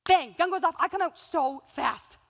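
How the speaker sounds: normal talking voice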